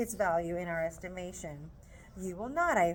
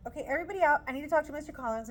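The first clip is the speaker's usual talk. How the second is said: higher, louder, fast command; bossy mom; 5 semitones higher, 5 dB louder, much faster than usual. Retold or imitated. Mom clearing the room, quick bossy order. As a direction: shoo everyone out. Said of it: "so Mom's like".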